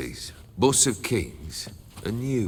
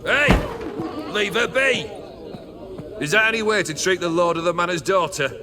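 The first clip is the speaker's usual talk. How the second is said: angry rebuke; 7 semitones higher than usual. Acted. Host scolding his men over a lady, angry shout. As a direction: snap at them to stop.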